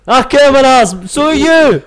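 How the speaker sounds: loud high mock shout. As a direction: bellow it back in play.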